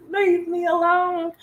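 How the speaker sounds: high, slow, icy brush-off; cold brush-off. Shown as a demonstration. Woman brushing off an approacher, cold and drawn out. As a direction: push them away with a chilly drawn-out line. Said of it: "I'm not saying be like".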